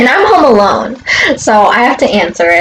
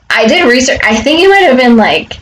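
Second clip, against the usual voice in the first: normal talking voice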